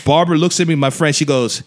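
ordinary talk

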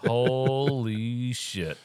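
very drawn-out, awestruck swearing; stunned exclamation. Retold or imitated. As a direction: stretch it right out in mock awe. Sees the huge dog.

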